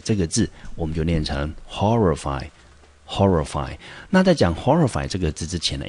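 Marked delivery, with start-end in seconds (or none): 1.7-3.8 horrify with HOR stressed, slowly; horrify, horrify: lean on HOR; stress on first syllable. Shown as a demonstration. Teacher models horrify for class, HOR stressed. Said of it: "中音节我们落在第一个音节".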